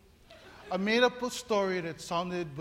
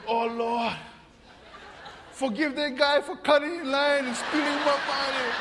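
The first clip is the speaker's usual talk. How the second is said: louder, higher prayer breaking into a laugh; mock prayer; 4 semitones higher, 3 dB louder than usual. Pastor praying for the thief, mock laughing prayer. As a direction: pray while laughing at it.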